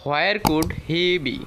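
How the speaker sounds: each word spaced out slowly for learners; slow model reading. Shown as a demonstration. Teacher modelling the question for students, slowly. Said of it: "এইভাবে বলবো".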